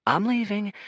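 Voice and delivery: soothing. Acted. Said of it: "trainer ... spooked lion".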